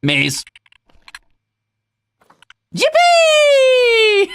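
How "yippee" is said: very high, loud squeal; a piercing squeal of delight; gleeful yippee. Real, not acted. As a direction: shriek with joy. Celebrating the result.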